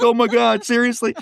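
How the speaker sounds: high, giddy and half-laughing; gleeful high-pitched amazement; thrilled disbelief. Retold or imitated. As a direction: react like you can't believe your luck. Reacting to big job offer.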